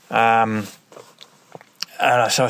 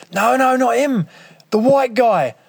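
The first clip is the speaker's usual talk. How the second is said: shouting; 13 semitones higher, 4 dB louder than usual. Retold or imitated. Police shouting in pursuit.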